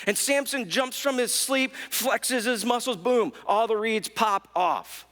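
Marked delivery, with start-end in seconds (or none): none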